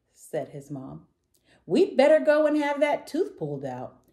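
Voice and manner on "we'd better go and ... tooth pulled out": storybook mom. A narrator's reading.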